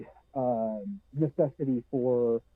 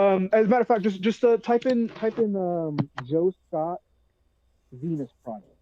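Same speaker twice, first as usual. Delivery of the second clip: muffled; 4 semitones higher than usual. Real, not acted. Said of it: "Sorry, that was really muffled".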